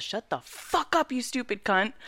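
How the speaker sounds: high, drawn-out mock furious outburst; losing it. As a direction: imitate a husband snapping in rage. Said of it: "Like, he lost it".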